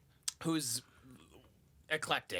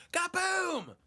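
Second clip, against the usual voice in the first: loud high boom shout; mock blast noise; imitated explosion; 13 semitones higher, 3 dB louder than usual. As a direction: yell it like a firework. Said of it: "and I hear".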